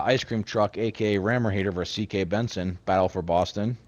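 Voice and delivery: ordinary talk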